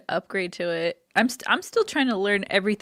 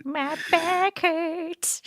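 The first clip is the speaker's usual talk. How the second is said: high squeaky voice; 9 semitones higher, much slower than usual. Pretend. Continues joke high voice.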